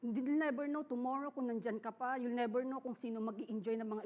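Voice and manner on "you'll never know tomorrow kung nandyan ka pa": ordinary talk, nothing marked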